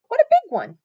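high, very loud and impressed; amazed exclamation. A narrator's reading. Missus Rogers seeing the caught fish, amazed exclamation. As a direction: exclaim with the voice soaring, then dropping.

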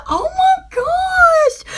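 high, slow, drawn-out shriek of surprise; amazed shriek. Retold or imitated. Realizing she lives on a circle, a shocked shriek. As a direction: stretch it out in high shocked delight. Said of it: "And I'm like".

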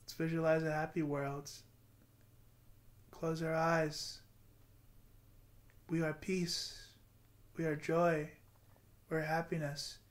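soft, slow counting and breathing cues; guided meditation. Acted. Vlogger guiding viewers through a breathing meditation, softly. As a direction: lead the breath gently, counting.

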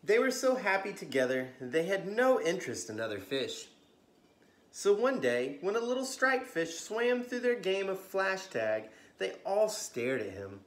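lively bedtime storybook reading; story narration. A narrator's reading. Storyteller reading to kids at bedtime, lively. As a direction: read it like a children's librarian at story time.

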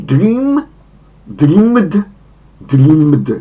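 dream, dreamed, dreamed with a drawn-out ee; long i. Shown as a demonstration. Teacher drills regular verb forms, long ee in dream and dreamed. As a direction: stretch the ee in every form, dream high and rising, dreamed falling. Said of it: "wydłużone i".

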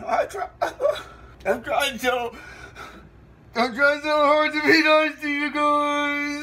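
high, drawn-out hurt wail; tearful whining. Acted. Host hurt by viewer's message, tearful high whine. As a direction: whine it slowly, on the edge of crying.

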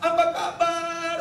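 put-on high, slow, drawn-out hello to a child; elated parent's sing-out greeting; happy greeting. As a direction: greet your child with delight, stretching the words. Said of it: "saya seneng gitu".

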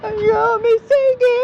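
high, slow, whiny mock lament; mock whiny girl. Mocking a girl in a saree. (ఎగతాళిగా ఏడుపు గొంతు)

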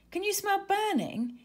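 high, worried sniffing question; alarmed question. Acted. Izzy worried about burning in the kitchen, alarmed. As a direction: sound the alarm about a smell.

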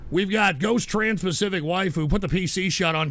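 normal talking voice

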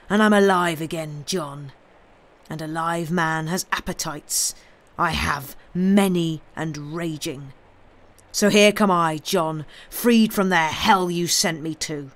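loud, vengeful threat; menacing. Acted. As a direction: hammer each word at him. Wronged man confronts his betrayer.

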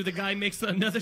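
normal talking voice

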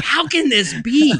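high-pitched incredulous protest; mock disbelief. Told he is best of the three, comically incredulous.

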